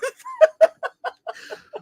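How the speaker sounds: high burst of laughter. Real, not acted. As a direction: crack up laughing. Laughs at the royalty joke.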